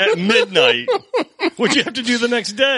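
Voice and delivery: laughing incredulous question; amused disbelief. Real, not acted. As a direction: ask it through laughter. Amused shock at friend's story.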